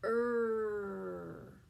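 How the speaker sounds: "Er" held as a growl; growled er sound. Shown as a demonstration. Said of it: "Let's try it one more time".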